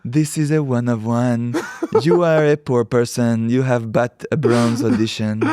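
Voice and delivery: put-on slow, haughty French-accented put-down; hammed-up French gallerist, taking it slowly. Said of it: "and the French accent".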